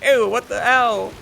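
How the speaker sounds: high, slow, squeamish "ew"; mock disgusted whine; disgusted wimp. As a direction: play the boss as a squeamish little beta. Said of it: "he's like".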